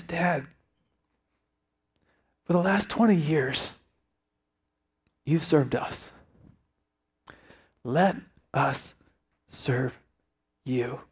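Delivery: hushed, halting plea to dad; understated tearful sincerity; tearful son. Retold or imitated. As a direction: retell it softly with long pauses, deeply moved. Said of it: "he's got tears running down his face".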